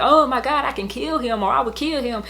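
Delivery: mock casual "kill him" outburst; imitated kids' exclamation. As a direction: toss it off like a kid's harmless hyperbole. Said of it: "you know how kids will say it".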